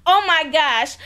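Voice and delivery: ordinary talk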